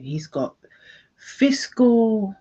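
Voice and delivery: ordinary talk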